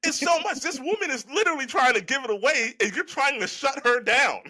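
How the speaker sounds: high-pitched, incredulous ranting; exasperated and worked up, pitch way up. Real, not acted. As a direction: rant in worked-up disbelief, voice riding high. Incredulous rant at self-defeating men.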